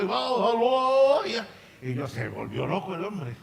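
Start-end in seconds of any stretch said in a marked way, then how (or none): 0.0-1.2 slow, high, loud yell; angry shout. Retold or imitated. Samir losing it over traffic fines, slow loud shout. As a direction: drag the word out in a furious shout.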